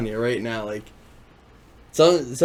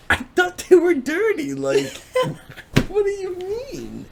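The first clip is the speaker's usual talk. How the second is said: much higher, squeaky fake-innocent voice; mock innocent. Pleading innocence to his girlfriend, squeaky mock-innocent. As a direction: play dumb in a high squeak. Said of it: "I'm like".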